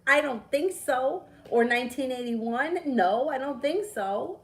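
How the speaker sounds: indignant disbelief. Real, not acted. Rejecting the dreaming theory with indignant disbelief. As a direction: scoff at the idea, drawn out. Mocking others' dreaming explanation.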